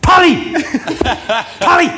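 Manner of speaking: retold shout of a friend calling a player. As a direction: yell a name to get attention. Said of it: "And suddenly he goes".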